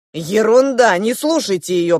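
loud, dismissive command; ordering. A narrator's reading. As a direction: brush it off loudly, then command them. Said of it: "приказал он сиамским близнецам".

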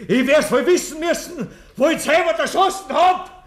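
high, loud laughing confession; manic laughter. Acted. Killer laughing as he admits the shooting. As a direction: laugh it out like a madman.

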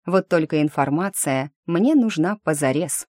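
ordinary delivery, nothing marked

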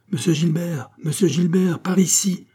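high-pitched repeated call. A narrator's reading. Calling Gilbert over to the rescued royals, crying out. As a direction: call someone over from a distance.

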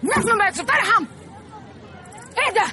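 loud, high indignant cry; outraged outburst. Acted. Nani bursting out at the rude man, loud outrage. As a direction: throw the outrage at the whole room.

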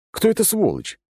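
surprised outrage. Acted. Пьяное возмущение.

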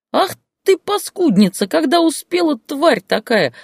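very high, loud shrieking scold; furious scolding. Acted. Grandmother screams at her pregnant daughter, high and loud. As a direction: rage at the daughter at full pitch.

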